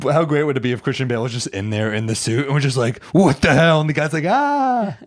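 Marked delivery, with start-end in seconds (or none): 3.1-3.8 louder, higher startled outburst; mock startled shout. Actor in the suit hit by a driver, pretend shock. Imagined Bale startled in the crash. 4.3-5.0 high, drawn-out pretend scream; mock panicked scream. Drunk driver seeing the hero, mock scream.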